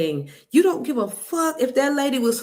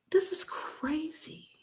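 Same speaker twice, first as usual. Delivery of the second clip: slow disbelieving whisper; stunned whisper; 7 semitones higher, 9 dB quieter, slower than usual. Real, not acted. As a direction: breathe it out, shocked. Stunned aside after the clip.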